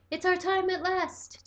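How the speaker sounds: high, slow gleeful cry. A narrator's reading. Toby cries out gleefully, high and slow. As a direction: savour the words, gleeful and high. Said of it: "Toby cried out gleefully".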